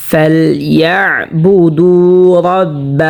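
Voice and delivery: very slow, drawn-out tilawat chant; slow melodic Quranic recitation. Shown as a demonstration. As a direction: recite each word with long held vowels. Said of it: "মধ্যে তবাই হয়েছে".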